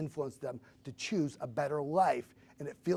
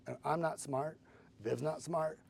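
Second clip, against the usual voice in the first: ordinary talk, nothing marked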